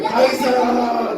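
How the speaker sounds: slow drawn-out call; Goliath calls David. Acted. Goliath calls across to David, slow drawn-out call. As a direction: drag out the name like a giant. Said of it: "얘기를 하는 거예요".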